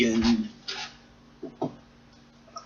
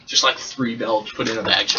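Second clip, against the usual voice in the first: normal talking voice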